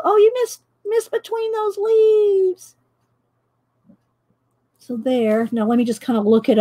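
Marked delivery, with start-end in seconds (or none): 0.0-2.8 high-pitched mock viewer voice; high voice, slowing into a drawn-out ending; teasing viewers imitation. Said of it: "Usually y'all go".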